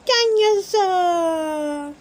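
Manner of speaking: put-on slow, high, drawn-out baby word; a tiny boy saying his favourite word; dreamy toddler dinosaur; toddler George. A narrator's reading. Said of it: "says George".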